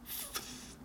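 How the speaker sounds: f f soprados e sussurrados; blown f sound. Shown as a demonstration. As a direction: sopro de f sem voz. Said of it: "esse som de f". (som de f soprado)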